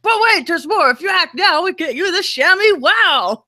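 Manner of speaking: high-pitched TV pitchman parody; hard-sell it like a late-night infomercial host; infomercial guy. Acted. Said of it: "I felt like an infomercial gu-, guy".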